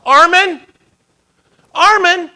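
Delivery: high, loud shout of a name; yelled call. Acted. Father yelling for his son under rubble, loud desperate call. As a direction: yell it out to reach someone buried. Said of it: "he yelled this out".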